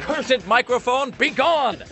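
high, slow mock-dramatic proclamation; hammed-up theatrical curse. Teasing his co-host, mimicking him in a hammed-up villain proclamation. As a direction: declaim it like a cursing wizard. Mocking partner shoving show away.

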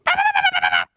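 very high, loud squirrel chattering bahs; shrill mock squirrel scold. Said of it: "do his, like, squirrel noise".

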